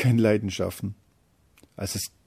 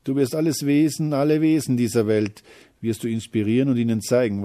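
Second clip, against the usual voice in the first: loud, swelling pep talk; rousing promise; 4 semitones higher, 7 dB louder than usual. Acted. Courage promises the little feeling greatness, rousing and loud. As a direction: proclaim it loudly, like a rallying speech.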